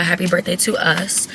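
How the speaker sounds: usual speaking voice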